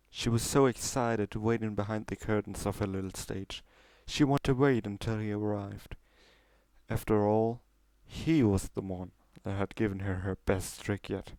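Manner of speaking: ordinary delivery, nothing marked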